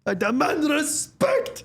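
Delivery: high, slow, self-important decree; pompous boss. Retold or imitated. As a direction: proclaim it like a petty tyrant. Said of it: "he just looks at everyone and he goes".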